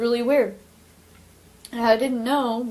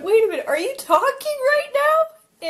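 much higher, astonished question; stunned disbelief; 11 semitones higher, 3 dB louder than usual. Retold or imitated. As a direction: relive the shock of hearing her bird speak. Retelling shock at talking bird.

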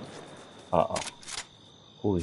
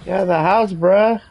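high, loud yell; shouting; 10 semitones higher, 5 dB louder than usual. Real, not acted. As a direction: yell it out urgently. Calling out in a fight.

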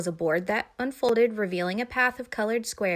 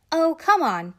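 put-on higher, louder girl's protest; 8 semitones higher, 6 dB louder than usual. A narrator's reading. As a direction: coax and object at once. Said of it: "protested Judy".

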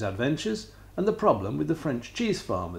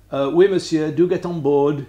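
put-on French accent; 3 semitones higher, 9 dB louder than usual. A narrator's reading. French passenger greets Poonlop.

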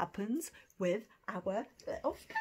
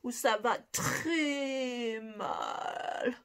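ça va très mal drawn out slowly; slow demo; 2 semitones higher, 3 dB louder, much slower than usual. Shown as a demonstration. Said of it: "For I'm feeling very bad".

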